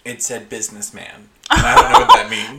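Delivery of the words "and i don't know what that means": laughing through the words, quicker. Real, not acted. Laughing to her friend about her old yearbook. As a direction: giggle it out.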